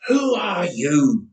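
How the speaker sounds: slow, strained cry from the fight; cried out. A narrator's reading. Jacob cries out to his attacker, strained and slow. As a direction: call out to the stranger mid-struggle. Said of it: "Jacob cried".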